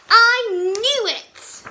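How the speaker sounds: very loud, slow triumphant shout; boy proven right about the spaghetti; delighted whoop. Acted. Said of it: "with a whoop of delight".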